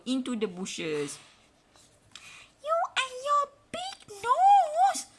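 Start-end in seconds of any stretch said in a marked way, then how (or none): 2.6-5.1 much higher, louder slow squeal; armadillo cries out. A narrator's reading. Armadillo blown into bushes, shrieks high and loud. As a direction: shriek it as an angry tiny armadillo. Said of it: "cries little armadillo".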